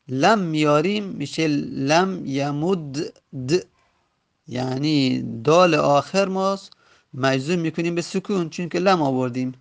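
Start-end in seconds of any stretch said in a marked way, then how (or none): 1.5-3.5 "لم یمد د" slowly, both final d's vowelless; two silent dals. Shown as a demonstration. Teacher showing why two silent dals clash, "یمد د" ending in vowelless d-d. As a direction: stop on a doubled bare d in "لم یمد د". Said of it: "دو تا دال حرف همجنس".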